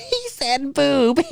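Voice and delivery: put-on a little boy snickering at a rude word; snickering kid.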